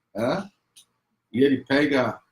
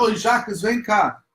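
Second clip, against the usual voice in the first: mother calling; 9 semitones higher, 3 dB louder than usual. Retold or imitated. Mother calls son over.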